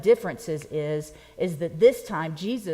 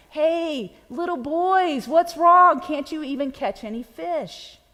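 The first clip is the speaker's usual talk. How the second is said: put-on a raised, teasing jab at the boys; taunting; 7 semitones higher, 5 dB louder, slower than usual. Jesus teasing fishermen with empty nets, light taunt. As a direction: needle them like old friends. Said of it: "He's kinda taunting them".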